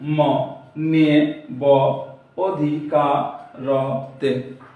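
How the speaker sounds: very slow, each syllable split apart; slowly sounding out the syllables of অধিকারতে. Shown as a demonstration. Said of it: "যতটুকু একবারে উচ্চারণ করতেছি".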